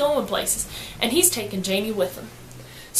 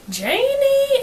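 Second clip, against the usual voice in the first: high swooning call of a name; boys calling out; 14 semitones higher, 3 dB louder than usual. Retold or imitated. Boys calling to Janie, high swooning call. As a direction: boys calling after a pretty girl, high and longing. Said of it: "all them boys be like".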